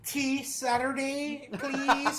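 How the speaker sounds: slow, high and laughing; mock polite through laughter. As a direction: tease the dainty truce with a laugh. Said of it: "And they're like".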